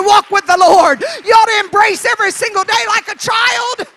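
loud, shouted revival preaching; exuberant preaching. Acted. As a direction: preach at full force with childlike excitement. Preaches at full force.

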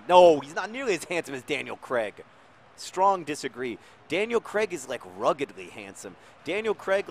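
mock outrage; indignant disagreement. Real, not acted. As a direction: insist Craig is handsomer. Arguing with wife about Bond.

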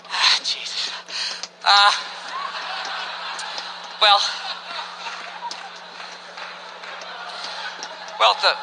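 halting, with long pauses; reluctant lady. Acted. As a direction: break bad news haltingly, a sigh first. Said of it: "So the lady's sitting there, she's like".

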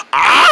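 loud scream breaking into coughs; attempted Wilhelm scream. Shown as a demonstration. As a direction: botch the movie scream and splutter. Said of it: "you gotta go like this".

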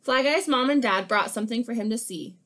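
lively picture-book read-aloud; animated storybook reading; reading to children. Acted. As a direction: read it like story time for kids.

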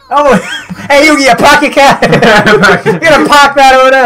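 high, hammed-up Boston accent; mock-Boston heckle, laughing; Massachusetts accent. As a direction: call out like a broad Boston local. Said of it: "we're from Mass".

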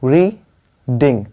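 Reading in two slow, separated beats; syllable per jump. Shown as a demonstration. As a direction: jump once on री, once on ding, with a gap. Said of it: "आप jumping करिए".

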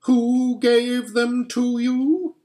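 slow, high, menacing demand; imperious witch, very drawn out; interrogating witch. A narrator's reading. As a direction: draw the question out high as the witch.

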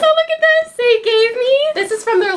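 high, thrilled exclamation; excited squeal. Real, not acted. Showing a gift they gave, excited squeal. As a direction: shoot the voice up in delight.

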